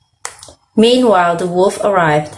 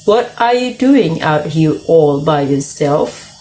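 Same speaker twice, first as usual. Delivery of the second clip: slow, lightly croaked wolf voice; a hint of a croak, taking it slowly; lightly voiced croaky wolf; slower than usual. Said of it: "he croaked".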